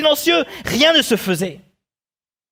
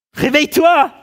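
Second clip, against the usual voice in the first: higher-pitched shout to wake someone; exasperated shout; 7 semitones higher, 4 dB louder than usual. Acted. Preacher acting out waking someone who stays silent, shouting. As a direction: shout to rouse a sleeper.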